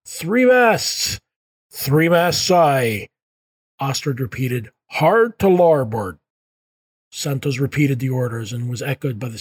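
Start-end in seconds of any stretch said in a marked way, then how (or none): 0.1-3.1 high, loud call from the masthead; shouting from crow's nest. Acted. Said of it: "the lookout called down". 4.9-6.2 shouted order. Acted. Captain orders the ship's turn.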